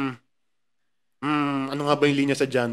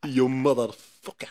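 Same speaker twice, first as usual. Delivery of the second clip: put-on Russian accent; 3 semitones lower, 4 dB quieter, slower than usual. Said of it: "funny rin yung Russian".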